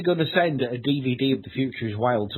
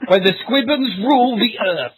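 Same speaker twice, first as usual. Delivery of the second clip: booming doom prophecy; 9 semitones higher, 6 dB louder, slower than usual. Pretend.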